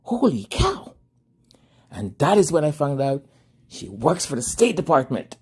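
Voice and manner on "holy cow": surprised. Retold or imitated. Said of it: "And I was like".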